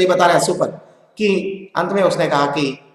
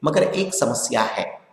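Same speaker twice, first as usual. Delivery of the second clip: thoughtful; 5 semitones lower, 7 dB quieter, slower than usual. A narrator's reading. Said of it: "कुछ सोचकर गांधी जी बोले".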